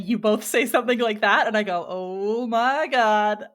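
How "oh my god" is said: very slow, stretched-out exclamation; drawn-out realization. Retold or imitated. Host reacting to co-hosts' words, drawn-out mock dismay. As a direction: draw it right out in mock dismay. Acts out own reaction to friends.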